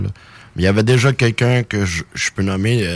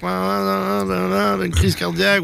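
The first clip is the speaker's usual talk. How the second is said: high-pitched mock panic, a string of rising "non"s; 10 semitones higher, slower than usual. Rapper mimics his first reaction to joual rap, high mock panic. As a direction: play your own past freak-out for laughs. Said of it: "moi, je suis là".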